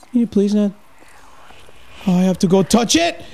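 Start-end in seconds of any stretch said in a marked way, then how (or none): none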